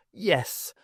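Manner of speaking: ordinary delivery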